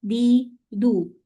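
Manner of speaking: दी and दू drawn out very slowly; slow syllable drill. Shown as a demonstration. Teacher repeating open syllables for the class. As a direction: stretch out दी and दू. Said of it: "उसको दा".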